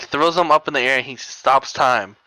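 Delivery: usual speaking voice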